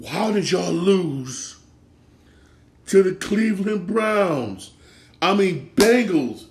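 high, slow incredulous question; can't believe it happened, stretch it out; drawn-out shocked disbelief. Real, not acted. Disbelief at the Bengals loss.

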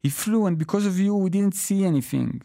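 high, fast, tearful accusation; a little boy blaming his father through sobs; child sobbing. A narrator's reading. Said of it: "Petey sobs".

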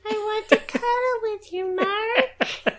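put-on high, eerie child voice; spooky little kid pleading; creepy child. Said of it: "creepy kid voice".

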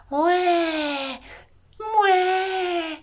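put-on high, loud baby wail; baby crying. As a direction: bawl like a newborn. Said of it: "he became noisier".

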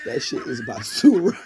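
amused and loud, laughing at the end; cracking up. Real, not acted. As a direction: let the punchline collapse into laughter. Cracks up at the insult.

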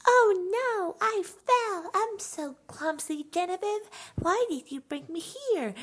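put-on high, whiny little-girl voice, far above her own; whiny little sister. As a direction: play a clumsy five-year-old feeling sorry for herself. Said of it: "she's always like".

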